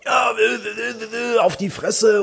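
high, slow mock thug stammer; stammering threat. Driver imitates the thug stammering a threat. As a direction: stumble over the threat like a dim brute. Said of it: "anbrüllte".

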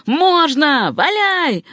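high, eager shout; high urging holler; crying out in support. A narrator's reading. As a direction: egg them on at a high pitch. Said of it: "вскричали другие".

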